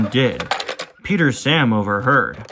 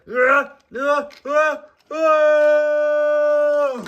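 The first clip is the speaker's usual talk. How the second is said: panicked cries; 12 semitones higher than usual. Acted. Chased by a dragon, crying for help.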